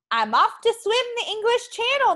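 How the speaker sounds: eager announcement. Acted. Norbert proudly announces to friends.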